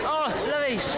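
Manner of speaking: thrilled to spot her at last; excited. Real, not acted. To viewers on seeing the author, excited.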